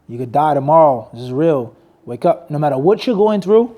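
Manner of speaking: ordinary talk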